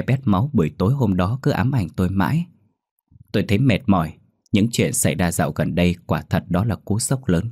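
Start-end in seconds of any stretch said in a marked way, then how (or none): none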